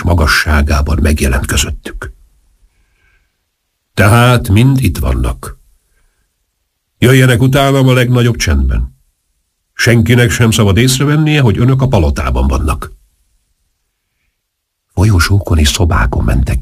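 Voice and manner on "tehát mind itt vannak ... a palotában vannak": lightly voiced major leading a secret party; commanding major. A narrator's reading. Major tells conspirators to follow him silently, measured command. Őrnagy az összeesküvőket titokban vezeti.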